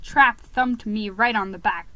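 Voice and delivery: plain reading voice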